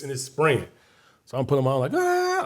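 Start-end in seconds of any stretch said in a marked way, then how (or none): 1.9-2.4 sudden high pained scream; retold scream. Teen in the wreck reliving the pain, high scream. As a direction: yelp like pulling a pinned arm free. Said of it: "I'm screaming".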